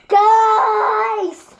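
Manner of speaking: loud, high call out. Real, not acted. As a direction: call out to the room. Calling out to others.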